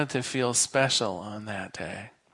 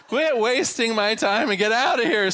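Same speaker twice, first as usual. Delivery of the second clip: higher, mock-angry order to leave; hammed-up bartender losing patience; annoyed bartender; 8 semitones higher, 4 dB louder than usual. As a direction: throw them out, playacting irritation. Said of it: "says the bartender".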